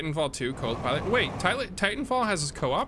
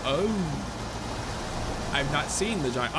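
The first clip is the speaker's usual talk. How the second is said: ordinary talk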